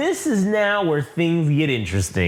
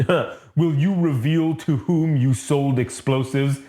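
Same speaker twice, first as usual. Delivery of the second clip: Vader voice; slower than usual. Retold or imitated. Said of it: "Vader's like".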